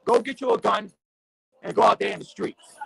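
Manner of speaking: impassioned street preacher rant; fired-up preaching. Real, not acted. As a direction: rail at the listeners like a pulpit sermon.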